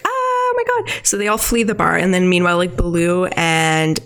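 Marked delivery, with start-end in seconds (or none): 0.0-0.9 scared shriek. Retold or imitated. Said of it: "everyone is like".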